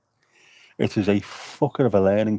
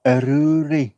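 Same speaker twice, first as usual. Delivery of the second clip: mock bird call; 5 semitones higher, slower than usual. Wood pigeons shouting a name, mock call. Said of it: "Maybe that's what they're shouting".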